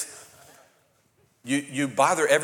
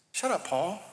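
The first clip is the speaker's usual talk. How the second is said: usual speaking voice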